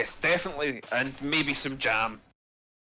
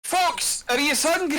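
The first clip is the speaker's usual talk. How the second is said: higher and louder call across the room; 6 dB louder than usual. Acted. The dwarf calling to Fox across the inn, higher and louder. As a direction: hail a friend from afar.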